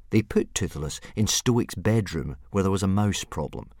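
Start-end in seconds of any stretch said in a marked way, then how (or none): none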